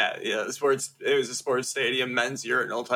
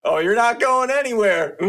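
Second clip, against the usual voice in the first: much higher and louder, gleeful command; gleeful Burns; 7 semitones higher, 7 dB louder than usual. Retold or imitated. Burns keeping the panda dancing, in a much higher louder gleeful crow. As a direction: crow it with villainous delight.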